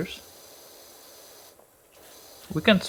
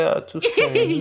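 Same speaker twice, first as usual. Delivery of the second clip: squeaky high voice. Pretend. After scary roar.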